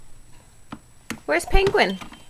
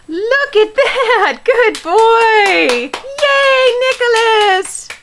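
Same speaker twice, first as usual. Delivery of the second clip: gasp, then cheer him on, much higher and louder; excited cheering; 10 semitones higher, 7 dB louder, much slower than usual. Real, not acted. Mother cheering her toddler for finding his giraffe, excited and loud.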